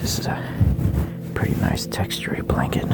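soft unvoiced whisper; ASMR whisper. Whispering to ASMR listeners about stereo sound. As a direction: hush it close to the mic.

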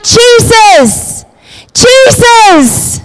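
pleading call pitched high. Retold or imitated. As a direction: call out to Jesus again, pleading. Beggar keeps calling out.

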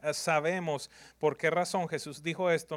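usual speaking voice

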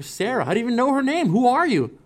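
high, rising disbelieving questions; high incredulous cry at the brother; shocked disbelief. Retold or imitated. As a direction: sound thrown by the name. Shocked he knows her name.

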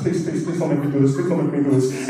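hushed, low mock begging; acted panic, kept small; panicked pleading. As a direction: plead under your breath like a scared little brother. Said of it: "my brother panicked".

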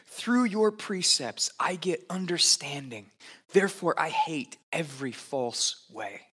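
slow, reverent reading aloud; scripture reading. Acted. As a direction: read the psalm like a prayer.

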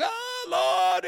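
high, loud mock wail to the Lord; anguished cry. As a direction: cry out like a desperate psalmist. Psalmists losing their minds.

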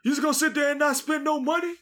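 very high-pitched mock DJ taunt; heckling club DJ. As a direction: imitate a DJ calling out cheapskates. Mimics a DJ heckling broke customers.

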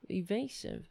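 normal talking voice

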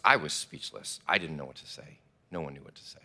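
normal talking voice

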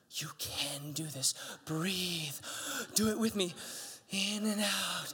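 soft, slow, hushed coaching; soothing father calming his son; calm reassuring dad. Retold or imitated. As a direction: gently talk the boy through breathing. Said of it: "And I, I say".

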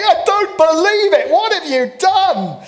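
a pastor pacing and wailing in mock disbelief; hammed-up meltdown. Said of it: "is in total meltdown".

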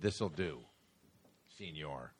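low, soft Mexican pharmacist voice; deadpan a low "senor"; a lightly voiced low shopkeeper; mock pharmacist. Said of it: "he said".

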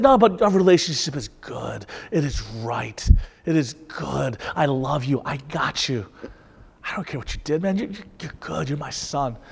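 soft, low fatherly reassurance; calm him like a dad holding his son; reassuring father. Retold or imitated. Father reassuring his son, soft and low. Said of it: "being said".

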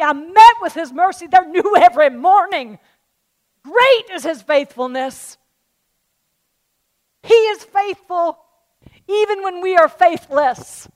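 loud, high proclamation of mercy; fervent preaching. Acted. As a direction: lift the church with it. Preaching at full force.

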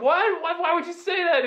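high-pitched, incredulous retort; shocked disbelief. Retold or imitated. Answering the laughing roommates, shocked and high. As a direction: act out being appalled by the question. Horrified reply to roommates' question.

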